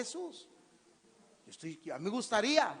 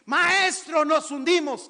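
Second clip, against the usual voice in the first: alarmed; 7 semitones higher, 10 dB louder, slower than usual. Acted. Disciples cry out in the storm.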